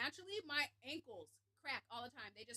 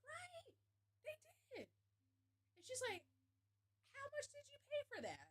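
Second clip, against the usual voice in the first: high mock voice; 12 semitones higher, 7 dB quieter than usual. Retold chat, high voice.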